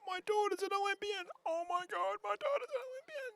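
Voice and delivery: very high squealed mock mum; excited mum. Commentator imagining Prue's mum reacting to Olympic news, squealing high. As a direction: squeal it like an overjoyed, tearful mother. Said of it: "if you, she goes".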